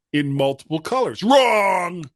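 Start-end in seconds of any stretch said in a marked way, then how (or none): 1.2-2.0 loud game show buzzer. Pretend. Buzzer-like verdict on prediction.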